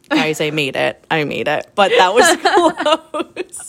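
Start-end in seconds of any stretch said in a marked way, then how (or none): 2.4-3.4 high, bright burst of laughter; real laugh. Real, not acted. Telling her co-host how close it was, real laughter. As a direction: laugh out loud at herself. End of her running story.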